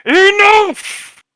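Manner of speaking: huge echoing roar from the forest; massive booming voice. Acted. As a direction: boom it out so the whole forest hears. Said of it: "massive booming voice that echoes".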